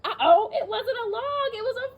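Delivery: teacher pretending to be scared; mock fright. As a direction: play up the scare for the kids. Gator revealed.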